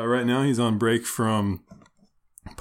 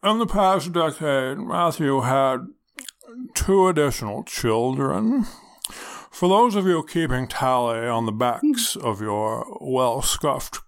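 Garrison Keillor; slower than usual. Acted. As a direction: read it like a folksy public radio host. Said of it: "Garrison Keillor".

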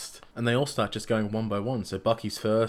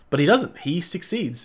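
loud, high-pitched disbelief; excited emphasis; 7 semitones higher, 4 dB louder than usual. Real, not acted. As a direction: burst out loud and high on doesn't. Twist he didn't expect.

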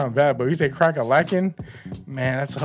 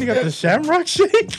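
mock milkshake order; 10 semitones higher than usual.

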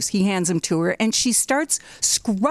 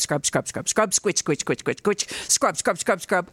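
scrubbing noises. Retold or imitated. Imitating scrubbing sounds.

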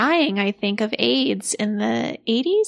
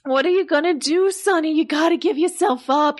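higher, shocked, fretful wife on the phone; Angie on the phone; 7 semitones higher than usual. Acted. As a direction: play the frantic wife who just saw him on TV. S1 plays Angie.

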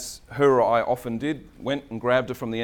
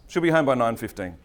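usual speaking voice

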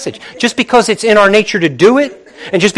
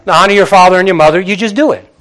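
ordinary talk, nothing marked

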